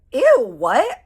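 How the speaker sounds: high, loud shocked ew; disgusted surprise. Retold or imitated. Friend hearing gross news in the bathroom, loud disgusted squeal.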